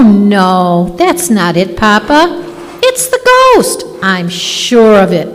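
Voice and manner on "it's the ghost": high, thrilled exclamation; excited. Acted. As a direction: blurt it out, thrilled. Daughter sure the ghost is here.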